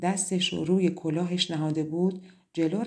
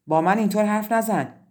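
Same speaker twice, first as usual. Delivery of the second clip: scolding; 3 semitones higher, 7 dB louder than usual. A narrator's reading. Mother scolding her rude daughter, sharp and loud. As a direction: put her in her place.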